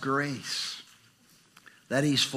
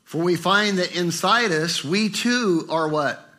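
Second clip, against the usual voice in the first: ordinary talk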